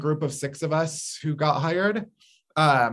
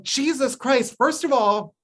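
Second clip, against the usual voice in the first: exasperated; 9 semitones higher than usual. Retold or imitated. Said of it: "I was like".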